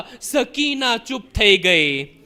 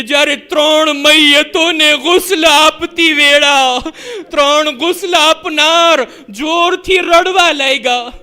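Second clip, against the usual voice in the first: louder, higher lamenting sermon; preaching at full force; 4 semitones higher, 5 dB louder than usual. Acted. Preacher to mourners, full-force lament. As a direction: drive the grief home to the crowd.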